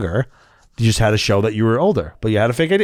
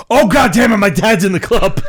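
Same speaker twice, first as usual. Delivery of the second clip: loud, high cry of dismay; alarmed outcry; 8 semitones higher, 6 dB louder than usual. Retold or imitated. Teller reliving spotting dad in the nightclub, alarmed outcry. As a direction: blurt it out loudly, reliving the panic.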